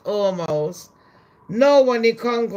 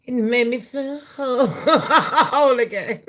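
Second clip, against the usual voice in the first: laughing through the words; 5 semitones higher, 4 dB louder than usual. Real, not acted. Comedian delighted by the feud, laughing. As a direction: giggle out whole again.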